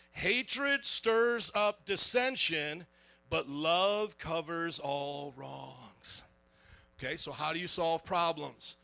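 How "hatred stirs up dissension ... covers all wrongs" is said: slow, solemn reading of the verse, much lower at the end; scripture reading. Shown as a demonstration. Pastor reads the proverb to the church, slow and solemn. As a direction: read the proverb with reverent weight. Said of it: "Here it is".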